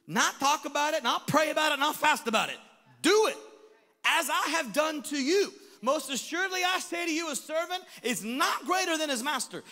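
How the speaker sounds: loud, high, emphatic preaching; forceful preaching. Real, not acted. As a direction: drive each phrase home at full force. Preaches scripture at full force.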